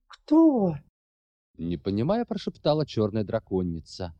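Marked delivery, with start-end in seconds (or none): 0.1-0.7 sharp, high startled ask; alarmed question. Acted. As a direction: blurt it in alarm. Dragoness asks in alarm.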